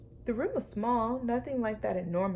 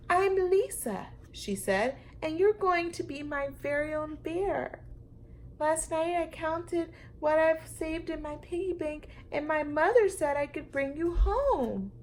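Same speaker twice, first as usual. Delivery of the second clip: put-on little girl voice; 8 semitones higher than usual.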